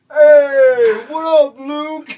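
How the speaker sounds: very high, loud happy shout; shouted greeting. Retold or imitated. Homeless man yelling a greeting back at the driver, high and loud. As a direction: holler back cheerfully from the sidewalk.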